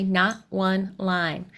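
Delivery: slow solemn imitation. Echoing Doctor's line slowly.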